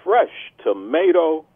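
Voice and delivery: drawn-out food-ad pitch; savoring ad read. Acted. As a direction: relish each ingredient, pausing between them. Sponsor ingredient read.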